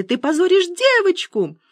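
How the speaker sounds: high-pitched reproachful cry; exclaiming. A narrator's reading. Wife reproaches old man over the girl, exclaiming high. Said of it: "воскликнула Циля".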